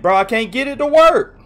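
loud, high-pitched frustrated complaint; exasperated cry. Real, not acted. Streamer complaining to viewers that nothing works, loud exasperated cry. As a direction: wail it out, at wits' end. App not working.

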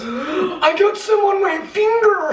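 high, slow startled cry; alarmed shout. Real, not acted. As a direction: yelp it out in shock. Powder touches finger.

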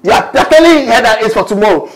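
usual speaking voice

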